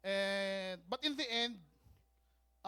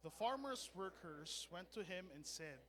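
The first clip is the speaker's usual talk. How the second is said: scripture reading; 2 semitones lower, 16 dB quieter than usual. Real, not acted. Pastor reading the parable with the congregation, hushed scripture reading. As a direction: read scripture softly, phrase by phrase.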